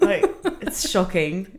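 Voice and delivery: slow, drawn-out British accent. Shown as a demonstration. Showing the host how drunk British sounds, slow British accent. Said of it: "I can speak in it".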